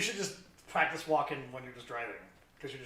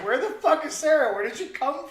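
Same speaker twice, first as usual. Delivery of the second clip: mock yell; 11 semitones higher, 9 dB louder than usual. Retold streamer's outburst.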